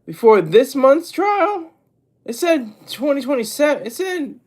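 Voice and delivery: high, incredulous double-take; real disbelief. Real, not acted. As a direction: read it back in baffled surprise, pitch jumping up. Startled by a conflicting trial date.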